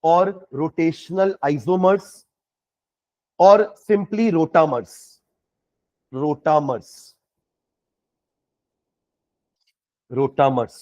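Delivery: slow, deliberate dictation; dictating. Real, not acted. Teacher gives another name to write down, dictated slowly. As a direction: repeat each term slowly for note-taking. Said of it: "और इसका एक और नाम लिखेंगे".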